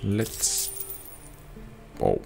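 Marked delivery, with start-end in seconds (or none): none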